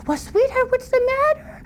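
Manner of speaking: lightly voiced high mother; a mother coaxing her daughter; hint of a fussing mother. Mother asks daughter what's wrong.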